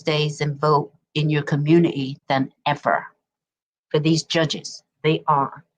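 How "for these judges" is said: ordinary delivery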